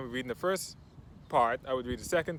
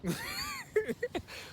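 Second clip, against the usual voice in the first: high-pitched amused laugh; real laugh; 6 dB quieter than usual. Real, not acted. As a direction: laugh at your own joke. Laughs at his soccer-dad joke.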